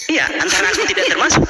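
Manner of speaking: high, quick sarcastic jab. Acted. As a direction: toss it off with sarcasm. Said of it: "karena saya sarkas".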